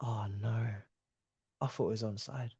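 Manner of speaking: hushed, low disbelief; stunned dismay. Real, not acted. Fan learns the goal is disallowed, stunned. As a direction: let it out almost under your breath.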